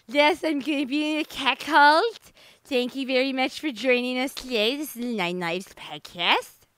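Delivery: imitating her lisping retainer voice; wearing a retainer. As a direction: replay the retainer-slurred intro. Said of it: "I can hear myself be like".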